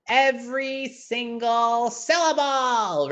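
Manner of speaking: EVERY SINGLE SYLLABLE stretched high and slow; "Every single syllable" with each syllable held long; every syllable drawn out. Shown as a demonstration. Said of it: "opening their mouth every single syllable".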